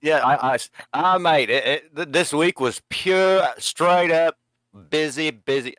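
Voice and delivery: half-hearted go at an Aussie friend's voice; Australian accent attempt. Adam, asked on stream, tries an Aussie voice, lightly put on. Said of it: "I can't do it".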